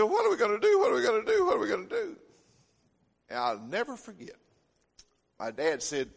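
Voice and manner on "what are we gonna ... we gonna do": high, loud panicked cries; a frightened mother's shrieks at the ruined house. Retold or imitated. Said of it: "Mama began to holler and scream".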